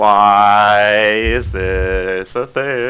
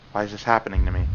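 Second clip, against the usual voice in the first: much softer, lower, breathless confession; lost in a feverish trance, murmuring; obsessed Yuri; 5 semitones lower, 8 dB quieter, much faster than usual. Acted. Yuri losing control.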